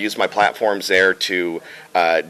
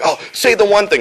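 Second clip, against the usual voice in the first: high, loud mock fan excitement; eager fan request; 12 semitones higher, 5 dB louder than usual. Fans asking for the catchphrase, high excited voice. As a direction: beg eagerly, pitched way up. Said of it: "people are like".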